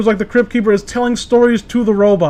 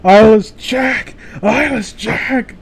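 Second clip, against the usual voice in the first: mock scream; 2 semitones higher, much slower than usual. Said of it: "just screaming".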